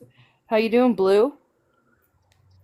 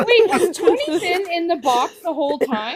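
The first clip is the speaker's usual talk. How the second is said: laughing; 8 semitones higher than usual. Real, not acted. Laughing at missing Tony.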